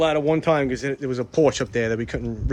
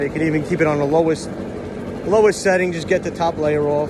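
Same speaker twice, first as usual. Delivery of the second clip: raised voice over a loud blower; yelling over leaf blower; 3 semitones higher, 3 dB louder than usual. Real, not acted. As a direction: talk loud over machine noise. Sorry, it's dust.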